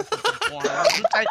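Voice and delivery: laughing squeal as he lands the joke. Real, not acted. Friends giggle at the raisin punchline, high laughing squeal.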